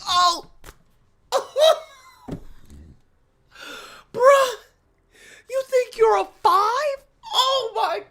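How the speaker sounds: high, loud laughing cry of disbelief. Real, not acted. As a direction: laugh it out, can't believe she said five. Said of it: "I wanted to tear up".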